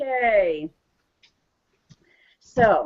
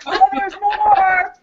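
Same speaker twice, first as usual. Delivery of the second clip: laughing delight; 8 semitones higher, slower than usual. Acted. Laughter yoga play.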